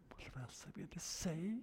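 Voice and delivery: voiceless stage whisper; whispered helpless aside. Retold or imitated. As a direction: confide it to the crowd in a whisper. Whispered helpless aside after the call.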